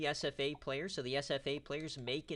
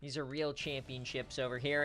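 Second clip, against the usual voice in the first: ordinary talk, nothing marked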